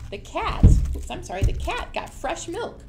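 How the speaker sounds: ordinary delivery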